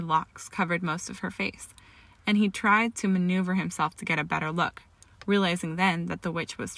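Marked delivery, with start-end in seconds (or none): none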